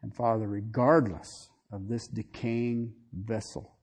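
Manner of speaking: soft, low, reverent pastoral prayer; closing prayer. Acted. Pastor praying over his brothers and sisters, hushed and reverent. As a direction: pray quietly over the people.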